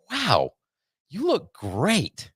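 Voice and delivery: high, slow, drawn-out imitated gushing; imitating a gushing dermatologist, high and slow; gushing doctor. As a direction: mimic a doctor overdoing the praise. Retold doctor gushing compliments.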